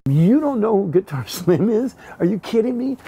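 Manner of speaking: can't-believe-it outburst, replayed; retold incredulity. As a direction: act out disbelief with a laugh creeping in. Retold disbelief at other's ignorance.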